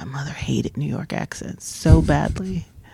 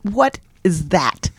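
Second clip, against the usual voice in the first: slow, higher-pitched mock disapproval; teacher mother; 4 semitones higher, slower than usual. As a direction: imitate a teacher mother demanding an explanation, slowly. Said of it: "My mother's a teacher".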